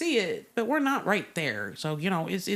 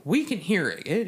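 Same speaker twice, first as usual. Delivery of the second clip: normal talking voice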